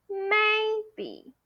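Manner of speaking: MAY held high and level in maybe, then dropping; high first tone on MAY. Shown as a demonstration. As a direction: stretch 'may' high, let 'be' fall. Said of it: "Remember that tone".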